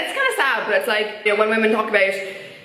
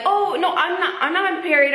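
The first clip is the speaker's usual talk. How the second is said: higher, protesting put-on voice; mock defensive denial; 6 semitones higher than usual. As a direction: imitate someone hastily denying it, pitched up.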